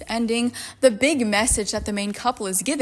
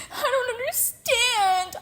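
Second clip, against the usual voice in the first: high, slow wail; mock cry; 12 semitones higher, slower than usual. Reading the heroine's grief, mock-crying high and slow. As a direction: wail it like a hammed-up sob. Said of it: "I cried".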